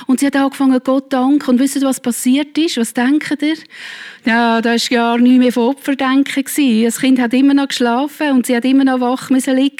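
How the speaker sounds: ordinary talk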